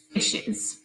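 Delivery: "Fishes" whispered; whispered answer. Shown as a demonstration. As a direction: whisper the word.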